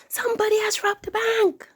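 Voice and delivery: high, loud panicked yell; screaming. Acted. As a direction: scream it, the bank's been robbed. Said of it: "He ran out and screamed".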